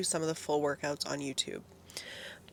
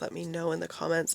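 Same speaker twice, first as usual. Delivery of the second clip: ordinary talk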